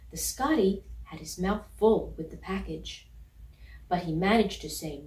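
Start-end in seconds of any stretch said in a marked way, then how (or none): none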